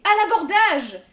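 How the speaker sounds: lancé plus haut et plus fort, en capitaine; cri de pirate. Acted. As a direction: crie à l'abordage comme un pirate d'enfant. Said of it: "J'allais crier". (le petit capitaine s'élance, cri de guerre joyeux)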